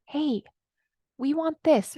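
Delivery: lightly voiced small, high voice; little inner voice. Inner voice speaking up to its owner, little voice. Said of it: "that little voice comes up".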